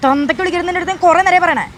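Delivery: high, loud outraged yell; furious warning. Acted. Woman warning him off insulting her father, furious. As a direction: explode at the insult.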